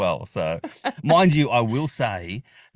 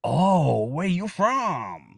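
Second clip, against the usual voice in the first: drawn-out, higher-pitched nosy question; imitated curiosity; 5 semitones higher, much slower than usual. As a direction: mimic people fascinated by an accent. Imitating people who hear the accent.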